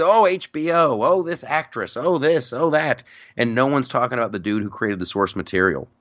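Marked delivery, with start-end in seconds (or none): 0.0-3.0 higher, mock-excited list of praise; mock gushing. Fans fawning over the show, mock gushing. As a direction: mimic the fawning crowd, pitched up.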